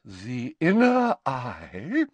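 slow, high, grand seer voice; stately, drawn-out and theatrical; with dignity. A narrator's reading. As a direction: pronounce it with wounded dignity. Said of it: "said Professor Trelawney with dignity".

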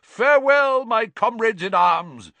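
high, loud, pompous knightly farewell; crying out. A narrator's reading. Knight takes leave of his companions, crying out. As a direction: declaim it grandly to comrades-in-arms. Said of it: "cried the knight".

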